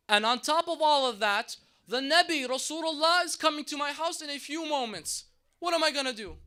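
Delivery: a mother coming apart, voice climbing as she cries out; loud anguished wail, pitch building high; distraught wail. Acted. Mother's despair over dead sons.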